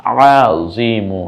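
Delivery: Shahida stretched syllable by syllable, each vowel held long; slow drawn-out reading. Shown as a demonstration. Teacher to learners, showing the joined word, very slow. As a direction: draw out Shahida slowly and clearly. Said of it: "গলায় চাপ দিয়ে রিমু".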